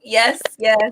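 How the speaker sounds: ordinary talk, nothing marked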